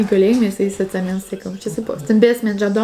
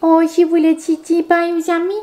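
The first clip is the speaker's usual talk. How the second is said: doggy mom voice; 5 semitones higher than usual. Real, not acted. Asking her dog what it wanted, high baby talk. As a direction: ask the puppy what it wants, sing-song.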